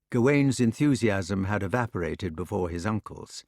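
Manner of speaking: ordinary delivery, nothing marked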